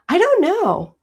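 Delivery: high-pitched, sing-song I don't know; baffled squeal. Real, not acted. Reacting to Patricia's question, high squeaky don't know.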